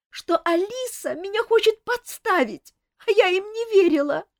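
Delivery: high, loud tearful plea; a desperate young woman begging; pleading favourite. Acted. Favourite begging the king, high and tearful.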